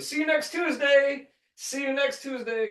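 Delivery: singsong workplace goodbye; mock coworker farewell. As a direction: chirp it like a coworker leaving shift. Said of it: "that's what they say to everybody".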